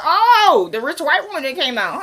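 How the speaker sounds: exaggerated high-pitched dawning realization; mock realization. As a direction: play up a sudden knowing recognition. Mock realization in retold remark.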